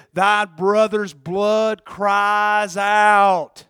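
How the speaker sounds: very drawn-out, weighty words; solemn, sustained quotation; slow scripture proclamation. Acted. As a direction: stretch each word out, swelling on "out". Quotes scripture for dramatic effect.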